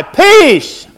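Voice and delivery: loud, high preacher's cry; high shouted exclamation, falling away. Acted. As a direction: cry it out to the congregation. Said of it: "and cry".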